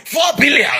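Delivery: high, mock-outraged Wike voice on Four billion; imitating Wike with a squeaky high pitch; Wike impression. Mocking Wike quoting the four billion figure, high and squeaky. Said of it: "Let me do my best Wike impression".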